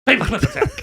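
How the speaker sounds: high-pitched laughing exclamation. Real, not acted. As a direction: burst out laughing through the words. Joking shout about the film weapon.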